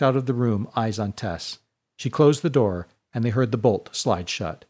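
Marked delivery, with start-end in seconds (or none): none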